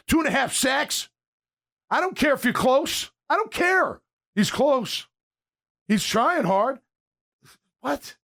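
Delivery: frustrated fan yelling at a pass rusher; fired-up urging. Real, not acted. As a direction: get on him like a frustrated fan. Urging a player to get sacks.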